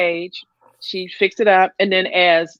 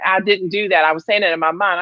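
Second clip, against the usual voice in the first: usual speaking voice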